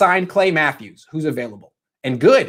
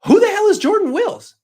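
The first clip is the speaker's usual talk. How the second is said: much higher, baffled outburst; incredulous fan. Retold or imitated. Guessing the fans' reaction to the trade, mock disbelief. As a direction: play the confused fan's disbelief. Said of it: "reaction was".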